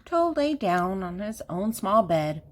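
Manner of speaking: ordinary delivery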